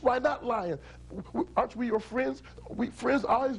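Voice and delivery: lightly acted, stammered objection; mildly hurt protest. Frogs protest lion's refusal.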